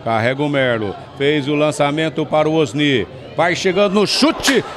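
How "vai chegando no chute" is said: soaring high shout for the goal; explosive goal commentary. Real, not acted. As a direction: erupt as the ball hits the net. Goal scored, narrator erupts. (narração de gol explosiva)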